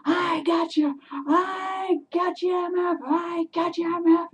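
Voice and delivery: high, drawn-out taunting yell; sustained high holler, stretched words; shouting. Retold or imitated. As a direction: re-enact hollering after a fleeing thief. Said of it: "shouting at him at the top of my voice".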